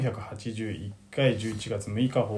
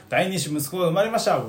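bright, higher, excited news; cheerful announcement; 6 semitones higher than usual. Shown as a demonstration. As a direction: announce it with joy. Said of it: "普通だったら". (嬉しそうに元気に発表)

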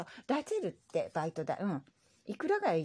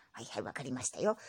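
lightly voiced old grandpa; grumbly old grandpa reply; old man voice; 9 semitones lower, 6 dB quieter than usual. Grandpa character voice.

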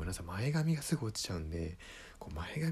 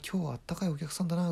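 pleased inner remark; 10 semitones higher, 3 dB louder, faster than usual. Retold or imitated. Comedian's inner thought.

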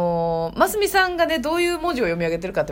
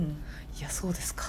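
whispered "そうですか" dropped far softer; whispered aside; 5 semitones lower, 14 dB quieter, slower than usual. Real, not acted. Hosts drop to a whisper over the odd group name. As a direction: hush it like a secret joke.

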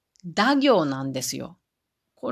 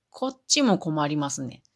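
usual speaking voice

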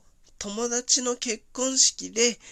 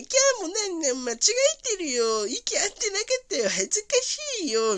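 embarrassed squeal; 9 semitones higher than usual. Retold or imitated. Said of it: "みたいな感じになっちゃったんだけど".

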